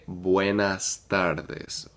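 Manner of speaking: Buenas tardes drawn out slowly and clearly; slow model phrase. Shown as a demonstration. Teacher slowly modeling Buenas tardes for the class. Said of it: "you would say".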